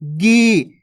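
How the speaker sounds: "गी" with a long, held ee; drawn-out vowel. Shown as a demonstration. Teacher answering for pupils, drawn-out "गी". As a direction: stretch the ee of "गी". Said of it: "खींच खींच के उच्चारण करना है".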